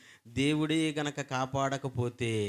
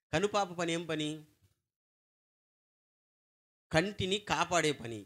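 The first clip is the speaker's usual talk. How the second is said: usual speaking voice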